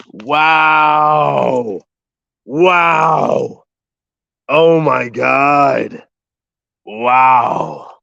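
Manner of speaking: high, drawn-out amazement; awed. Real, not acted. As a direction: marvel at the view. Awed by the forest.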